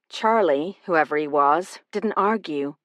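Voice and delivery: usual speaking voice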